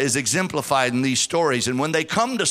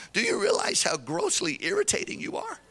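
quiet aside; 13 semitones higher, 6 dB quieter than usual. Retold or imitated. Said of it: "take you on the side and say".

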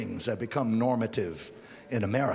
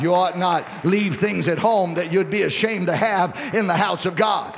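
loud, raised pulpit exhortation; sermon climax at strong volume; forceful preaching; 7 semitones higher, 7 dB louder than usual. Acted. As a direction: press the warning home, high and loud.

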